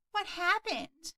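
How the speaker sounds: a hint of a worried mother; lightly voiced mom.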